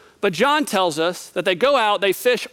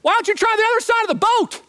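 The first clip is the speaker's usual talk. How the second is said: much higher called-out suggestion; yelling back; 12 semitones higher, faster than usual. Retold or imitated. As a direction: shout it across the lake. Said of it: "he yells back".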